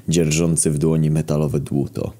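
plain reading voice

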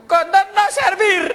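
high, slow hollering in broken Spanish; hammed-up outraged customer; escalated complaint. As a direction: cry out CONDÓN NO SERVIR, every word stretched. Final, escalated complaint in the joke.

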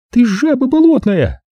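put-on high girlish insult. Zaza insults him.

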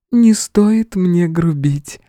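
slow, high, honeyed warning; tender female voice. A narrator's reading. Goddess warns hero about rudeness, sweetly. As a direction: scold gently in feminine pitch. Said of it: "Всё та же ласковая интонация".

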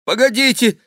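high, loud plea; pleading wait. A narrator's reading. The king begging the plotters to wait, high and anxious. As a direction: beg for a moment's delay. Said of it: "сказал король".

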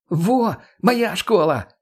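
high, delighted exclamation; gleeful. A narrator's reading. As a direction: crow proudly over the pupil's success. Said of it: "грохнула от смеха Марго".

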